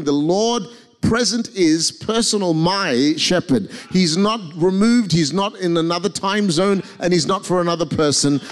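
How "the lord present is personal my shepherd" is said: slow, weighted "my shepherd" with "my" stressed; declaring. Shown as a demonstration. Pastor models the declaration for the congregation, slow and firm. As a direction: claim it as your own. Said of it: "leave today declaring".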